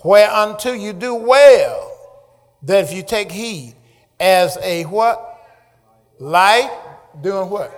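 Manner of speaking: loud, ringing proclamation; forceful scripture reading with prompting questions; preaching scripture. Acted. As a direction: call it out phrase by phrase.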